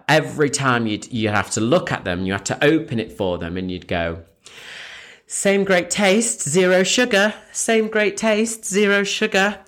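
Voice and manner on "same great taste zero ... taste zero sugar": high, drawn-out slogan call; mock promo-girl sales call; promo patter. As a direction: chirp the slogan over and over. Acting out her promo slogan patter.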